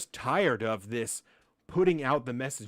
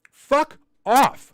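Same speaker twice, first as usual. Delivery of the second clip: angry; 12 semitones higher, 15 dB louder than usual. Real, not acted. Angry outburst at politicians.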